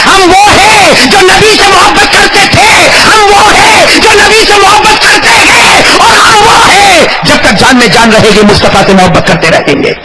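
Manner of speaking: high, slow, near-crying shout; preaching at full force. Acted. Preacher declaring love for the Prophet, tearful shout. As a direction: build the vow to a tearful peak.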